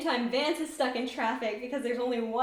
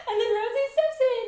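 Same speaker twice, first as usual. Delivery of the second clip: high, giggly laughter breaking through; cracking up while retelling the fight; laughing; 4 dB louder than usual. Real, not acted. Laughing at the scene.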